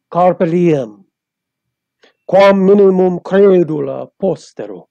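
Latin poetry recitation. Shown as a demonstration. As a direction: declaim the verse with weight. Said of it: "So I will do this in Latin".

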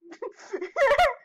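loud high cackle; high burst of laughter. Real, not acted. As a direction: break into a sharp laugh. Laughing at friend.

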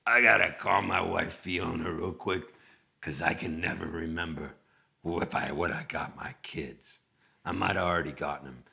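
low, lightly put-on singer's voice. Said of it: "And then he goes".

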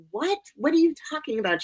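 high, quick, baffled question; retold puzzled mother. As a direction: imitate a mom taken aback. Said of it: "She was trying to calm me down".

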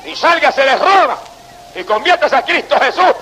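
fervent preaching at the crowd, pitch up; a Spanish preacher calling Rome's faithful to flee to Christ, fervent preaching; full-force preaching. Acted. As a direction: preach it hard and urgent.

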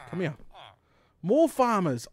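ordinary talk, nothing marked

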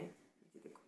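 ये देखो breathed as a soft whisper. Real, not acted. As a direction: whisper it so nobody nearby hears. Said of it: "बहुत धीरे बोल रही हूँ".

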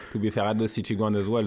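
ordinary talk

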